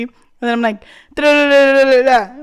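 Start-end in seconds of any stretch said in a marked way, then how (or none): 1.1-2.4 loud placeholder babble. Retold or imitated. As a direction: rattle it off loudly. Filler babble quote.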